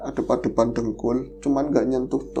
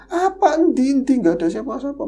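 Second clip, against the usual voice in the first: brother's puzzled reply; 10 semitones higher than usual. Retold or imitated. Brother searching, sees nothing.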